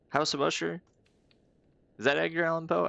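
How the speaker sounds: surprised recognition. Real, not acted. Recognizing a literary reference.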